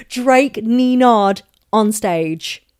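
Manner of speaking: slow, loud, stretched-out words; drawn-out, punched delivery; emphatic repeat. Real, not acted. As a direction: spell out the pun loudly and slowly. Repeats pun slowly for effect.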